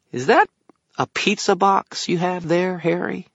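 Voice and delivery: fish for it in a high fairy pipe; calculating fairy. A narrator's reading. Fairy eyes the wizard's pizza box, calculating high voice. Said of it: "eyes calculating".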